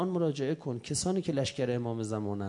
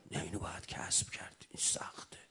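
whisper; 6 semitones lower, 7 dB quieter than usual. Acted. Intimate aside to congregation.

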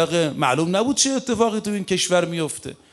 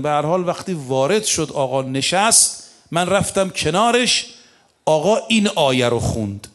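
ordinary talk

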